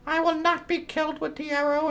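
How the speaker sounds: high falsetto cackle into a taunt; mock villain laughter. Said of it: "she's laughing it all".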